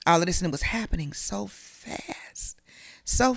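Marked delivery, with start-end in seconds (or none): 0.5-2.6 whispered. Real, not acted. Overwhelmed retelling.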